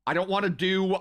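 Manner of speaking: ordinary talk, nothing marked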